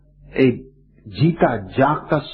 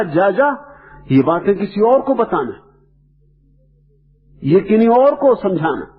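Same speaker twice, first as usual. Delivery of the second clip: acted father waving his son off, high-pitched; retold indignant brush-off, pitched high; angry dismissal; 6 semitones higher than usual. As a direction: dismiss him like an offended father.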